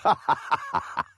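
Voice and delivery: short high mocking laugh; giddy killer's laugh; high cackle. Villain cackles at hidden prey.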